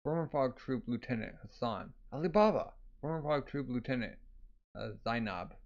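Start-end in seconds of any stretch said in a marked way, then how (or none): 2.1-2.7 a raised, falling cry of recognition; exclaimed name. Acted. Introducing the Fog Gang members, exclaimed name. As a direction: call the name out, higher pitched.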